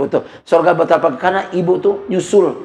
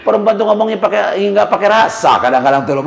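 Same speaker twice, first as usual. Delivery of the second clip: ordinary delivery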